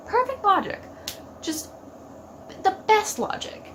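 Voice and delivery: high, mock-impressed sarcasm; sarcastic. Real, not acted. Fan mocking Dean's reasoning, dripping sarcasm. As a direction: praise the logic, meaning the opposite.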